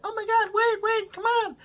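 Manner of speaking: mock frantic begging in a high voice; panicked plea. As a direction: squeak out a panicked wait wait. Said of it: "and they're like".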